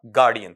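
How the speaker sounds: गार्डियन said with a hard d, ending low; teacher giving the final answer; correct pronunciation. Shown as a demonstration. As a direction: land गार्डियन with a clear d. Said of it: "ये क्या बना?".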